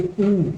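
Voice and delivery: ऊ held long with rounded lips. Shown as a demonstration. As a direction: stretch the ऊ slowly with round lips. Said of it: "जैसे बोल के देखिए". (होठ गोल करके लंबा ऊ)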